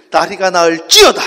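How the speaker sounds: emphatic preacher's command; loud proclamation. Shown as a demonstration. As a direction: proclaim it big, driving into the last word. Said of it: "크게".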